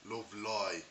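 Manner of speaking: lilting Birmingham accent; demonstrating a weird West Midlands Brummie accent. Shown as a demonstration. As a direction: talk like a Brummie. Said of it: "in the life of a Brummie".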